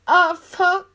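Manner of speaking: high, loud ecstatic cry; loud pleasure cry. Acted. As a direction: cry out at the peak.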